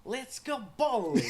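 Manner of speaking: put-on high Eastern European accent; GTA cousin voice. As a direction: mimic the game cousin. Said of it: "Just repeating G T A four".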